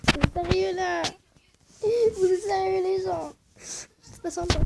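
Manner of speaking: high, slow, whining like about to cry; whiny complaint. Real, not acted. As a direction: plead to the viewers with a crying voice. Upset at losing the game.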